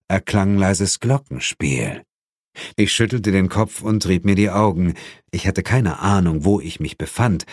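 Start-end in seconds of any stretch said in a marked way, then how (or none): none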